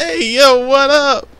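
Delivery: high, loud hype greeting; hype man voice. Acted. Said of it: "I need my hype man".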